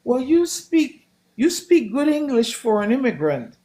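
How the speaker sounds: condescending stranger. Retold or imitated. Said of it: "I was told recently".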